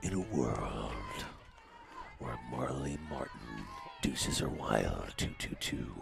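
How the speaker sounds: low, dramatic trailer narrator; movie trailer voice. Pretend. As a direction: boom it like a film trailer. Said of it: "Sound kind of like a movie trailer guy right now".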